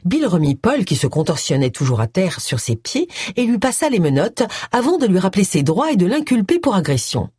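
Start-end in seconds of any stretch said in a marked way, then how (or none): none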